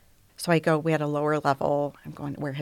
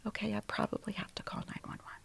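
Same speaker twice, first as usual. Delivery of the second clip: soft unvoiced whisper; shaky whisper; 4 semitones higher, 12 dB quieter, faster than usual. Deciding to call for help, whispered to herself. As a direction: whisper it, resigned and scared. Realising she must call 911.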